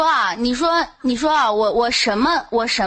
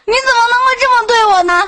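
high, loud playful protest; hurt, whiny accusation, half laughing; mock outrage; 9 semitones higher, 7 dB louder than usual. Real, not acted. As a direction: wail that she's been fooled. Caller protests being tricked.

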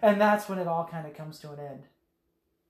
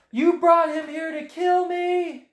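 slow, loud, higher accusing Anakin; hammed-up angry Anakin; angry accusation; 10 semitones higher, 6 dB louder, slower than usual. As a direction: hurl the betrayal at her slowly.

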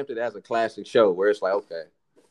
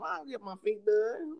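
high woman voice; 9 semitones higher, 4 dB quieter than usual. Retold or imitated. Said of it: "women be like".

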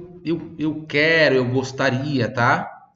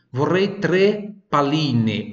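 ordinary delivery

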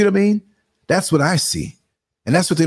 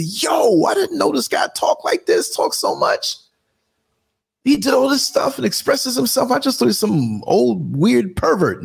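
high-pitched imitation of amazed coworkers; mock astonishment; 13 semitones higher than usual. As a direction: play amazed gossips, pitched way up. Voices coworkers' astonishment at him.

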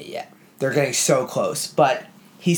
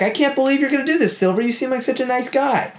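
much higher, fast, incredulous; shocked sailor; 11 semitones higher, faster than usual. Retold or imitated. Honest sailor stunned that friendly Silver would mutiny, high and fast. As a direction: blurt it out in betrayed disbelief. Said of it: "And the guy's like".

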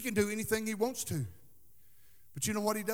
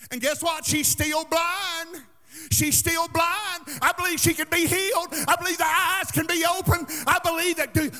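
high, punched pulpit declarations; full-force preaching; 8 semitones higher, 11 dB louder than usual. Real, not acted. Preacher insists the blind can be healed, full-force preaching. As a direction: drive each belief home, voice raised.

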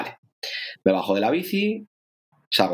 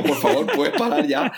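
laughing plea; 4 semitones higher than usual. Retold or imitated. Retold plea while peeing long.

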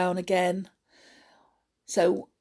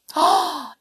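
excited gasp; 8 semitones higher, 4 dB louder than usual. A narrator's reading. Children see the roundabout.

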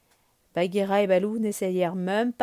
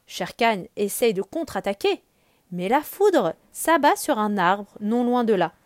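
plain reading voice

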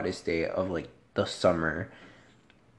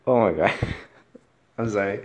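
ordinary talk, nothing marked

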